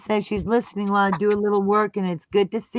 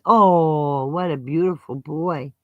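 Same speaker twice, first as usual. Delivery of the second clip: usual speaking voice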